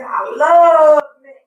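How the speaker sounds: slow emphatic shout. Real, not acted. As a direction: belt it out slowly. Emphatic declaration.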